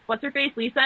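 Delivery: ordinary talk, nothing marked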